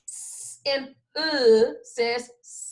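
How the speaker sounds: drawn-out S and UH, then joined as sluh; sounds, then blend. Shown as a demonstration. Teacher showing the class two sounds mixed, drawn out then blended. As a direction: stretch each sound, then blend them. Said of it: "mix them together really quickly".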